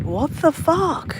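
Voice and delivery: high, slow, cracking cry of disbelief; tearful disbelief. Retold or imitated. Crying on getting the last message first. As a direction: let the shock break into tears. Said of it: "burst into tears".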